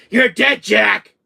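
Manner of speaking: sudden high, loud yell; acted outburst, punched out loud; defiant shout. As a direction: shout it at the monster. Player bursts out at villain.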